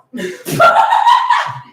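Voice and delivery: loud high laugh; loud shrieking laugh. Real, not acted.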